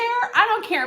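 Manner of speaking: high, indignant burst; angry outburst. Real, not acted. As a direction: throw it out in real outrage. Outraged at pastor's remark.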